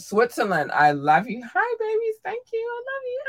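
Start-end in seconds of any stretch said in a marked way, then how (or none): 1.4-3.2 squeaky cute voice. Acted. Cutesy shoutouts to listeners.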